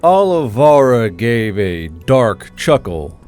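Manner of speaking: ordinary delivery, nothing marked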